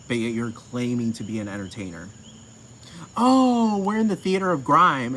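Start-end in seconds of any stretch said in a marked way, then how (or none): 3.2-5.2 high, loud mock-grand exclamation; mock theatrical. Mocking the crew's claim to be entertainers, mock theatrical. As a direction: declaim it with sarcastic grandeur, pitched up.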